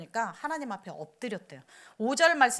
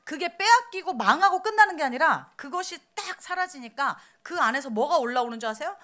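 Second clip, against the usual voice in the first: ordinary talk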